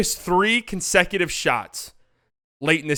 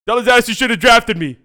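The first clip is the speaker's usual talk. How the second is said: higher, louder mock Luka taunt; hammed-up trash talk, high and loud; 7 semitones higher, 8 dB louder than usual. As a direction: gloat loudly at the man who passed on you. Voicing Luka taunting Divac.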